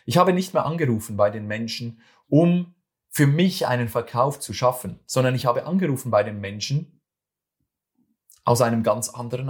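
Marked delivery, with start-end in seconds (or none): none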